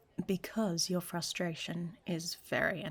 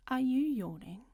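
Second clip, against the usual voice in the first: higher, louder indignant question; surprised; 6 semitones higher, 4 dB louder than usual. Acted. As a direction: catch them yawning, outraged. Reacts to yawn mid-seduction.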